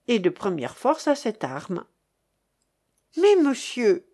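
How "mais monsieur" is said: higher, louder alarmed protest; a timid notary crying out in dismay; frightened cry. A narrator's reading. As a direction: blurt it out in a panic. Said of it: "s'écria encore le pauvre maître Régime".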